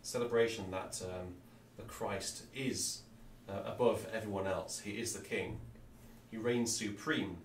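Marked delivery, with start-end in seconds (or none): none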